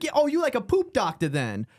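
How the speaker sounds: high, hammed-up put-on comic voice; imitating the comic. Comedian grills the plumber about toilets, hammed-up high voice. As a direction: go up high and mock the slangy comic.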